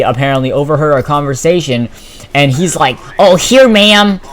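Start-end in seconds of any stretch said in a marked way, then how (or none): 3.2-4.2 high, loud roll-call answer; imitated eager student. Robbie answering the teacher's roll call, high and loud. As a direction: pipe up as a keen classmate.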